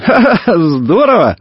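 high acted laugh into delight; hammed-up boyish chuckle. A narrator's reading. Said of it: "засмеялся Иван".

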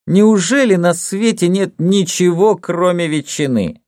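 high, drawn-out disbelieving whine; exasperated complaint. A narrator's reading. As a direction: complain slowly at a high pitch. Gloria complains about the food.

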